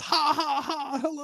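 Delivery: high nervous laugh into hello; nervous fake laugh. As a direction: cover with a forced laugh. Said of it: "He goes".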